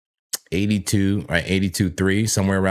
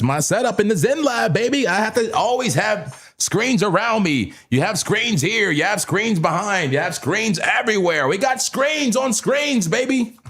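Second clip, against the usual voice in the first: hyped bragging; 12 semitones higher than usual. Real, not acted. To his viewers, bragging about his screens, hyped. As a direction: show off the Zen Lab proudly.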